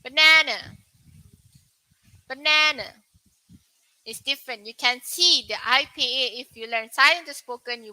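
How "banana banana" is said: banana with a flat "a" in the middle, bə-NA-na like "nanny"; American pronunciation. Shown as a demonstration. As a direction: model the American banana for learners. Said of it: "For U S, it's different".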